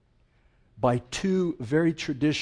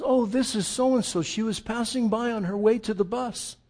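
lightly voiced grandmother, higher and bright with welcome; a hint of a welcoming old grandmother, pitched up; cheerful grandmother; 7 semitones higher than usual.

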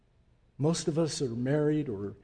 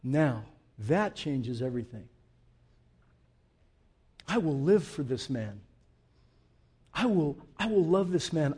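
hushed devotion; 4 semitones lower, 3 dB quieter than usual. Acted. Quiet devotion after loud climax.

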